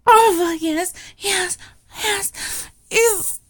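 loud ecstatic moaning yes; loud cries of pleasure. Acted. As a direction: let it burst out, climbing. Climax in erotic roleplay.

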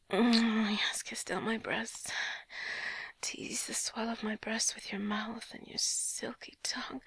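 ordinary talk, nothing marked

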